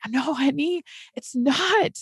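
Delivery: soft, gentle mom voice; tender reassurance. Retold or imitated. Mother gently telling her nine-year-old it's okay, softly.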